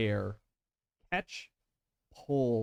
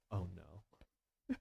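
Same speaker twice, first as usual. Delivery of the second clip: much softer, sinking "oh no"; dismayed murmur; 4 semitones lower, 12 dB quieter than usual. Acted. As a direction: realise with quiet dread. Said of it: "she's like".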